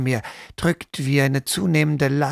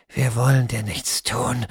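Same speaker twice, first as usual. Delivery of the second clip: ordinary delivery, nothing marked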